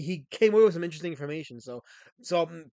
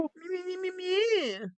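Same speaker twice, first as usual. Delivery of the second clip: mocking whine; 14 semitones higher, slower than usual.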